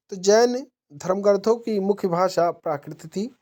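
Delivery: ordinary delivery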